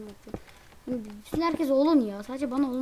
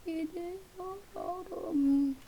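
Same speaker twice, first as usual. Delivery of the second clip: drawn-out sing-song; 6 semitones higher, 5 dB quieter, much slower than usual. Real, not acted. Leaving the match, fed up.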